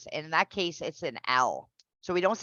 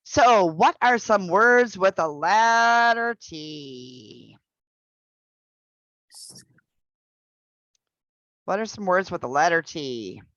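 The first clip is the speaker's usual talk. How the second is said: slow teacher question; 3 dB louder than usual. Shown as a demonstration. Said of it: "teacher asks class slowly".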